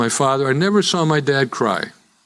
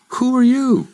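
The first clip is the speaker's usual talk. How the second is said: high, loud startled cry; startled question; 13 semitones higher, 3 dB louder than usual. Retold or imitated. Man on subway asks the devil, startled high cry. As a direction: jump in fright at the stranger.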